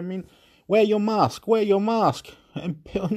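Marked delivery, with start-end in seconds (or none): 0.7-2.3 mask order. Retold or imitated.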